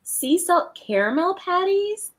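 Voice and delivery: normal talking voice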